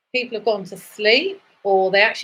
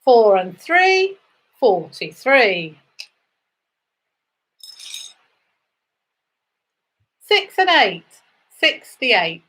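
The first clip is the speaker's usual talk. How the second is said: sing-song number calling, rising then falling; bingo caller; slower than usual. Acted. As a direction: call each number out to the hall.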